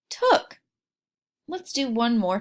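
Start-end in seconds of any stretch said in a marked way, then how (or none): 0.1-0.6 "took" blended on a high pitch; blended word. Shown as a demonstration. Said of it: "Let's look at the word took".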